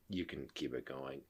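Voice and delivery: subdued, noncommittal mimicry; imitating unenthused wife. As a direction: drop to a near-murmur, quietly opting out. Retold wife losing interest.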